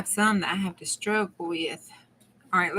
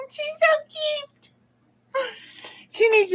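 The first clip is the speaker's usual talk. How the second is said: cooing. Real, not acted. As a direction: coo over something adorable. Cooing over a fairy stamp.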